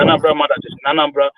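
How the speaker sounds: ordinary talk, nothing marked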